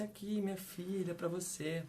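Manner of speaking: soft, tender offering to a granddaughter; loving grandma. Retold or imitated. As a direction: hand it over gently, full of affection. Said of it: "todo carinho". (avó carinhosa, voz suave e terna)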